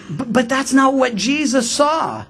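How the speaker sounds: ordinary delivery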